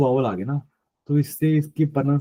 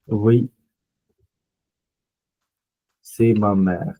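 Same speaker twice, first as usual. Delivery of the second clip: slow model dialogue; 2 semitones lower, much slower than usual. Shown as a demonstration. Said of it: "Other example।".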